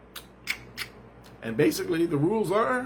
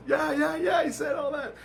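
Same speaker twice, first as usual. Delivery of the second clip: high-pitched eager confirmation; imitated excited wife. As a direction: put on a high voice for the wife's answer. Voicing his wife's excited answer.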